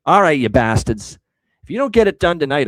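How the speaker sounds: loud, forceful address; emphatic retake. Acted. Bettor warning the Seattle team to win tonight, loud and emphatic. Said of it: "I'll say it again".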